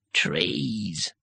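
one long, drawn-out word; woody tree voice. A narrator's reading. Tree breaking into the wizards' talk, woody timbre. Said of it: "can only be described as timbre".

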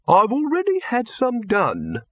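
high, slow, haughty reply; cold. A narrator's reading. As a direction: say it coldly and deliberately. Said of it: "said Silverfish coldly".

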